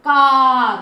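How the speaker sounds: कार loud, with the "aa" held long. Shown as a demonstration. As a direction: draw out कार. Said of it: "आ का sound आ रहा है". (कार ज़ोर से, लंबा "आ")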